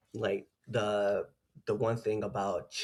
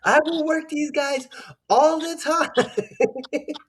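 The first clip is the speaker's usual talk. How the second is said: very high, excited squealing voice; elated squeal; 7 dB louder, much slower than usual. Retold or imitated. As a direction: squeal it out with delight. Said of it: "I just remember the conversation of".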